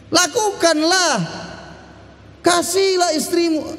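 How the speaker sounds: teriakan lantang, nada tinggi; seruan perintah. Acted. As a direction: serukan perintah Tuhan dengan keras. Quoting the command to husbands. (pengkhotbah meneriakkan perintah kepada para suami)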